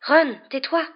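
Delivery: ordinary delivery, nothing marked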